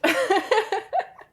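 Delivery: high bursting laugh; loud real laugh; big laugh. Real, not acted. Friend jokes about getting sick.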